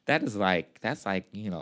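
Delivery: usual speaking voice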